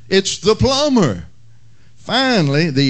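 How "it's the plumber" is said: higher, desperate mock shout. Desperate plumber searching the house, higher mock shout. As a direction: call out, growing desperate. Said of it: "increasingly desperate and shouting out".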